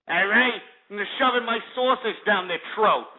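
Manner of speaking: cocky Italian-American tough-guy accent. Shown as a demonstration. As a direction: strut like a Jersey Shore guido with an Italian-American accent. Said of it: "you want me to sound like a wop".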